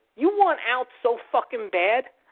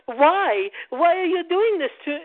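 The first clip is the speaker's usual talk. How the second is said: a lightly done, bad English accent, higher; 8 semitones higher, slower than usual. Mocking her complaint to management in a bad English accent. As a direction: imitate her plea in a half-hearted English accent. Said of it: "that's a bad English accent".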